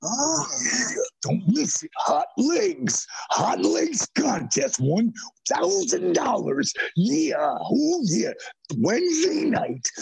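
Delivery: high, pumped-up radio ad hype; high announcer voice hyping a contest; radio promo hype voice. Retold or imitated. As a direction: sell the contest like a hyped wrestling promo. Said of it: "I might throw in".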